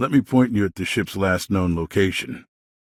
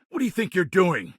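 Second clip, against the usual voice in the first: confronting; 7 semitones higher than usual. Acted. Guard confronts someone.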